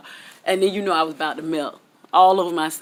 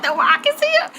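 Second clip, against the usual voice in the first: much higher, loud squeal of excitement; fangirl squeal; 4 dB louder than usual. Retold or imitated. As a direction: squeal like a starstruck fan. Spots The Rock's plane.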